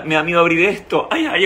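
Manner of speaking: usual speaking voice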